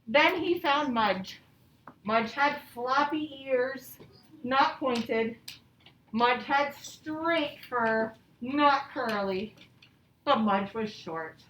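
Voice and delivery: slow, clear storybook reading; patient read-aloud to young pupils; reading to children. A narrator's reading. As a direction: read it out slowly for the class.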